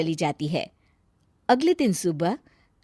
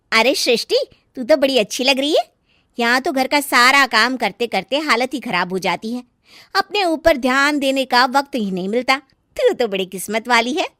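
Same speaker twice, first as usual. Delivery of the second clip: friend's voice; 3 semitones higher, 5 dB louder than usual. Acted. Friend visiting Srishti, mildly envious and gushing. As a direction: gush over her a little enviously.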